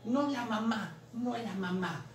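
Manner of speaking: lightly voiced TV baby catchphrase; playful baby-character quote; Bebé Sinclair impression. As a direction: imitate a sitcom baby refusing mama. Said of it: "¿Cuál era su forma de hablar?".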